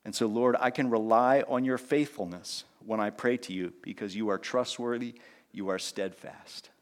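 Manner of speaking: soft, sincere prayer to God; earnest spoken prayer. Acted. Pastor prays aloud for the church, soft and sincere. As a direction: talk to God quietly, trusting.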